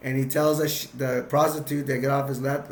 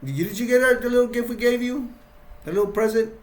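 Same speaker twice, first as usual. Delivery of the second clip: higher, mock-innocent asking about the gift; lightly voiced sly question, pitched up; sly Jack; 8 semitones higher, 3 dB louder, faster than usual. Jack slyly asking the sheriff about the bribe, mock-sweet. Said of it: "Jack's like".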